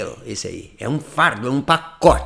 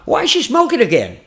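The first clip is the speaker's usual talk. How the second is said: higher, one connected burst; fluent, packaged delivery; 8 semitones higher, 4 dB louder than usual. Shown as a demonstration. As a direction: run it together with confidence. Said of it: "Chega uma hora, vocês falam". (frase empacotada, solta e mais aguda)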